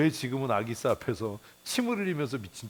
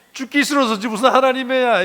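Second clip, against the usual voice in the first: much higher and louder, hammering the point; shouted repeat; 12 semitones higher, 12 dB louder than usual. Real, not acted. Preacher repeating the line to the congregation as a shout. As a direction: shout it out the second time. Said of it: "repeats the punchline louder".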